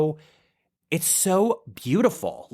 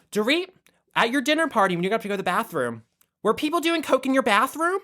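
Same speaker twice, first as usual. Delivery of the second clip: much higher, prying mock question; imitating Lisa Rinna; 8 semitones higher, faster than usual. Lisa Rinna asks Dorit about the bathroom, high and nosy. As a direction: pitch it way up like gossip. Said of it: "she's like".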